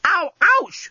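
high-pitched yelp of pain; pained yell. A narrator's reading. Journalist with a wound being grabbed, yelling in pain. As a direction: yelp as if hurt. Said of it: "the journalist yelled".

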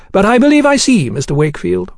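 high, courteous gentleman's voice; politely insistent gentleman. Acted. Gentleman overriding the head waiter, politely insistent. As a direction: politely brush the objection aside, pitched high.

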